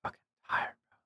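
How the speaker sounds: soft unvoiced whisper; whispered inner voice. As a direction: whisper it like a tired thought inside your head. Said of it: "my mind would be like".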